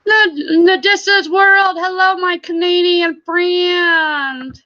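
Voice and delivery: high, drawn-out sing-song hello; real high-pitched delight; excited greeting. Real, not acted. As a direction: greet an arriving friend in a high, stretched voice. Greeting arriving chat member.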